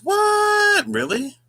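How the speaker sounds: high, loud, drawn-out "what"; real amazement. Real, not acted. Host learning a viewer used to keep bees, real amazement. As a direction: burst out in high-pitched disbelief.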